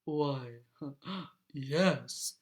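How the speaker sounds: low, smug dragon with a chuckle; flattered dragon, chuckling low. A narrator's reading. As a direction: answer deep and pleased with yourself. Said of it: "said the dragon".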